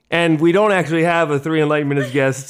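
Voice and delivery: ordinary talk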